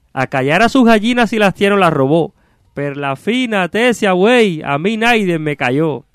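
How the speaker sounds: high-pitched, declaimed cursing in verse; theatrical exasperated ox-driver; irritated carter. Acted. Stuck carter cursing whoever called him, exasperated.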